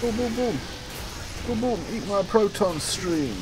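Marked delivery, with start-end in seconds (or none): 0.0-1.8 gun noises. Pretend. Shooting, sound effect.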